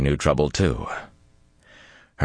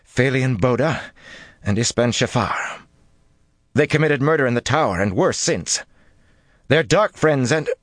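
gaining strength; 9 semitones higher than usual. A narrator's reading. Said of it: "gained strength as she went on".

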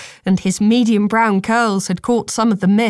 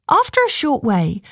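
ordinary delivery, nothing marked

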